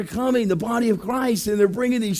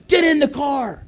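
high, raised-voice command; imitated urgent order; 6 semitones higher, 3 dB louder than usual. Guard fetching the pastor by car, high shouted order. Minder arrives, orders him in.

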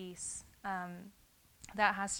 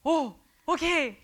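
scared reply; 7 semitones higher, 12 dB louder than usual. Retold or imitated. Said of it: "I was so scared".

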